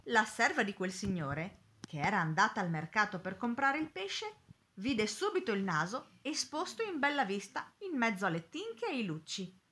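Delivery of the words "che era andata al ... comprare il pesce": plain reading voice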